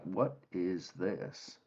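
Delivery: low, soft, slow mutter. Acted. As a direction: mutter it slowly under your breath, like a scornful onlooker. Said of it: "other kinds of muttering".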